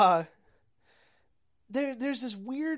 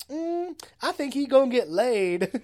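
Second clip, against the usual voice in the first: high, knowing "mm", then louder verdict; sassy onlooker; 4 semitones higher, 5 dB louder than usual. Retold or imitated. Woman on the mountain commenting on the tire, sassy. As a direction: comment loudly like a nosy spectator.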